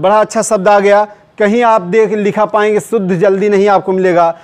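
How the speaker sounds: ordinary talk, nothing marked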